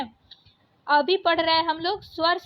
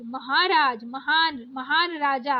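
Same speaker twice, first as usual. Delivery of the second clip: ordinary talk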